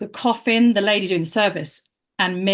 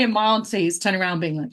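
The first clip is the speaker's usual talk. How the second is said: usual speaking voice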